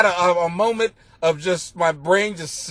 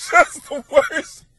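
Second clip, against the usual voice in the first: rueful laugh; 7 semitones higher, much slower than usual. Real, not acted. Laughing at his awful day.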